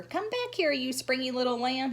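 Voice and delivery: high, playful call across the yard. Acted. Sheldon calling after Lucille while chasing her. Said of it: "he called".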